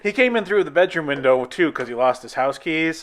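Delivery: usual speaking voice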